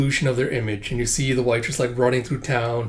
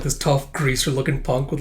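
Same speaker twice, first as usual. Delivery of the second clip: ordinary talk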